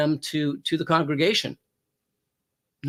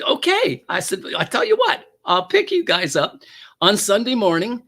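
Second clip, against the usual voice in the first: high, raised retold agreement; startled agreement; 5 semitones higher, 5 dB louder than usual. Officer agreeing to drive couple to church, startled and high. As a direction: jump in, caught by surprise.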